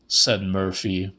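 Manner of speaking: plain reading voice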